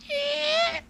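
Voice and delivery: very high-pitched imitated squeal; mock gawking squeal. Streamer mocks someone watching pimple videos, high mock squeal. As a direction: squeal it high, aping a creepy viewer.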